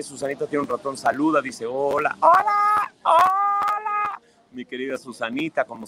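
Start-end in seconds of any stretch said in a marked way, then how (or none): 1.7-4.2 tiny squeaky voice, pitch climbing; squeaky hello. Pretend. Voicing Susanita's mouse greeting in a squeak.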